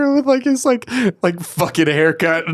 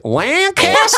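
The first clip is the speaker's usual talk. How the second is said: imitating her Lancaster; 13 semitones higher, 4 dB louder, slower than usual. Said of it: "you literally say Lancaster".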